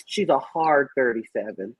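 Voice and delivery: usual speaking voice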